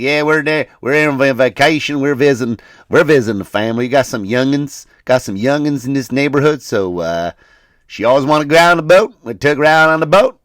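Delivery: mock Southern US twang; Southern US accent. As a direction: imitate a chatty Southern vacationer. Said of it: "he sounded just like that".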